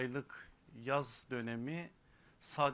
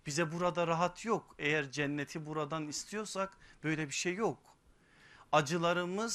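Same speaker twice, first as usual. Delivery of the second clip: high, loud, impassioned sermon voice; fiery preaching; 4 semitones higher, 6 dB louder than usual. Acted. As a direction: preach it out with rising force. Sermon rising to full force.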